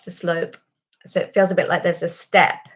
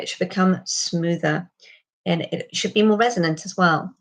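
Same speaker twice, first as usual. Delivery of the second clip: ordinary talk, nothing marked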